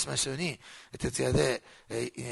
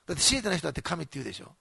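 usual speaking voice

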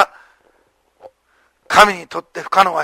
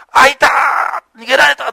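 a drawn-out, higher startled cry; alarmed shout; 5 semitones higher, slower than usual. Retold or imitated. Jailer waking to find the chains broken, crying out in alarm. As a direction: gasp it out as the jailer sees the empty cells.

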